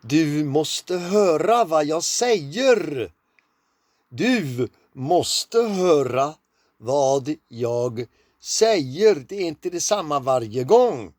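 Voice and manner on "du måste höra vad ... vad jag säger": slow, loud Swedish, each word drawn out. Shown as a demonstration. Teacher slowing down to say listen to what I say. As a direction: spell it out slowly, word by word. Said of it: "You have to slow down".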